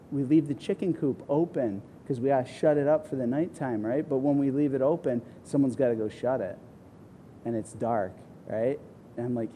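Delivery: ordinary talk, nothing marked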